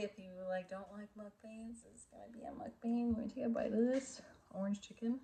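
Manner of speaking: hushed whisper, no voice. Real, not acted. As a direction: whisper it like a secret. Whispered aside before first bite.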